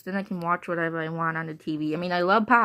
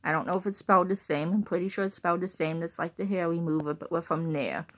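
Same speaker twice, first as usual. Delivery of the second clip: ordinary talk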